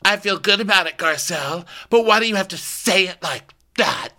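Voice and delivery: high mock-earnest objection; Rinna. Rinna objecting to Garcelle's wording, high mock protest. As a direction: push it high, in mock dismay. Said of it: "And then Rinna goes".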